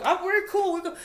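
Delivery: very high, loud placating reply; mock reassurance. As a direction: act out brushing off the boss in a high voice. Retold reassurance to angry boss.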